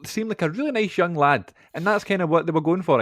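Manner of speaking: ordinary talk, nothing marked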